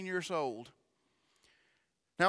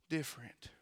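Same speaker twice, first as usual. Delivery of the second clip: ordinary talk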